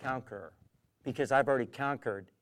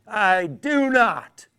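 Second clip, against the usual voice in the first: mocking scoffer; 6 semitones higher, 10 dB louder, slower than usual.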